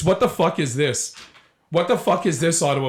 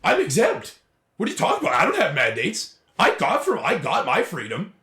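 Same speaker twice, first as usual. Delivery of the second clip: mocking echo. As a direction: parrot it with exaggerated pitch swings. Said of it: "Yeah, there it is again".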